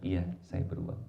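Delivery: low, meek agreement; acted husband calmly conceding; kind reply. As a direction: give in gently to the insult. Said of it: "suami balasnya dengan kebaikan".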